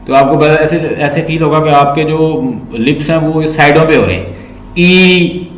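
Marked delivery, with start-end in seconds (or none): 4.8-5.5 ई held with lips spread to the sides; teacher demonstrating spread lips; vowel ee demo. Shown as a demonstration. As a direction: smile wide and say ई. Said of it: "अपने मुंह से कहें ई".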